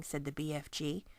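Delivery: plain reading voice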